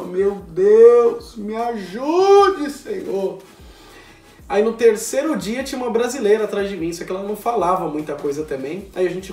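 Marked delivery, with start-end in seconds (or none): 0.0-3.4 loud, drawn-out cry to God; loud desperate plea. Retold or imitated. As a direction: beg the Lord for help, hammed up. Desperate prayer in class.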